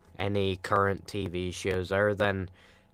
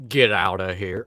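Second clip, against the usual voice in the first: movie quote, louder; 5 dB louder than usual. Retold or imitated. Quoting a Dumb and Dumber line.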